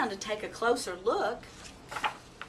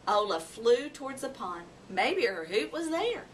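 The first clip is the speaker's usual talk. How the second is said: plain reading voice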